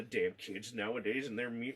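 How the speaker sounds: low, soft grumble; grumbling old man. Retold or imitated. Ozzy scrubbing the wall, low grumble about kids. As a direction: mutter it under your breath like a crank.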